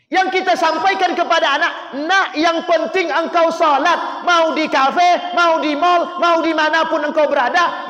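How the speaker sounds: high, loud rebuke in the parents' words; fiery preaching. Acted. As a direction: scold the congregation for what they tell their children. Preacher mocks parents' advice loudly.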